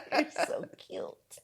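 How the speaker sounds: high squeal through a laugh; gushing, giggly and slow. Real, not acted. Laughing affection to partner.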